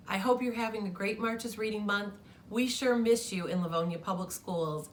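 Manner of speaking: plain reading voice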